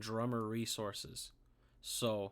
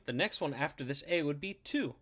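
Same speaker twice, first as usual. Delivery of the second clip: ordinary delivery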